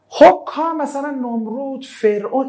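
ordinary talk, nothing marked

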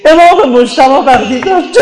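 grieving cry, breaking into weeping; tearful lament. Real, not acted. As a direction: cry it out as a mourner. Mourning eulogy, Imam's death.

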